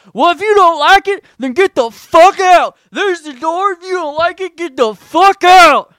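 high-pitched, full-blast mocking scream; hammed-up shouted caricature, stretched at the end; mock furious yelling. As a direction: screech it as an outraged church member. Mimicking church people's angry retort.

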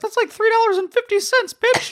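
high laughing outrage; laughing indignant. Real, not acted. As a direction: laugh while scolding.

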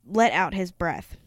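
ordinary delivery

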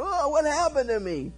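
high-pitched alarmed wail; mock panic. King finding his hand withered, mock panic. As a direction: act out startled dismay, pitch jumping up.

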